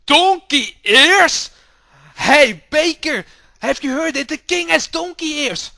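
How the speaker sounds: high, loud, gossiping townsperson; excited gossip. Acted. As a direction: spread the news loudly and eagerly. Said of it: "And they all go like".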